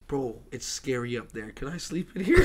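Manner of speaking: low, hushed plea, imitated; mock-timid, low and quiet; retold timid request. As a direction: ask softly, like a spooked friend at night. Retold scared friend asking to stay.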